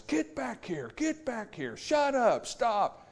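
high-pitched yell at a charging dog; retold yell. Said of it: "and yells".

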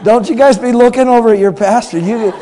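loud, high playful scolding; loud teasing. Real, not acted. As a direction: wag a finger at the crowd, loud and high. Preacher teasing congregation, loud.

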